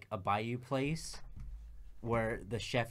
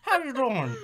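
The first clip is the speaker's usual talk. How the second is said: high, loud mangled Southern put-on; mock accent; 12 semitones higher, 7 dB louder than usual. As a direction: claim a homeland in a goofy Southern accent. Continues the put-on accent, laughing.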